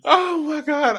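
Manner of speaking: breathless from laughing; gasping after a laughing fit; out of breath. Real, not acted. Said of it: "I am out of breath".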